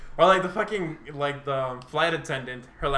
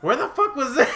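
much higher and louder, indignant disbelief; retold disbelief; 10 semitones higher, 5 dB louder than usual. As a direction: yelp it like you can't believe he's already friended her. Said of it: "I'm just like".

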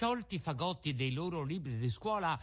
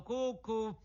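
high, sing-song taunt; high teasing call; mocking; 9 semitones higher than usual. A narrator's reading. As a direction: taunt them with a cuckoo call. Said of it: "in segno di canzonatura".

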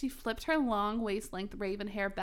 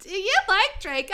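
high, loud mock squeal; exaggerated excited question; hammed-up shouted question; 10 semitones higher, 9 dB louder than usual. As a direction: blurt it out way too loudly. Acting teasing friend's question.

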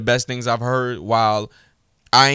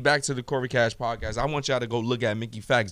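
usual speaking voice

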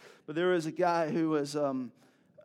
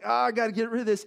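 hurried exclamation; 6 semitones higher, 8 dB louder, faster than usual. Retold or imitated. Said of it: "And I was like".

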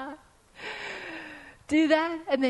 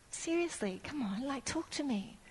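quiet pleading; 15 dB quieter than usual. Retold or imitated. Pleading with God in prayer.

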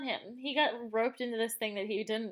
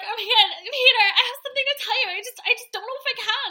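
much higher, louder, fast breathless panic; dramatic woman; 11 semitones higher, 9 dB louder than usual. Retold or imitated. Mocking her confession to Peter, high fast panic. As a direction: rush it out in a laughing mock panic. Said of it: "she's like".

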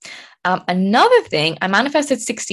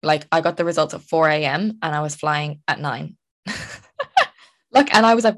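usual speaking voice

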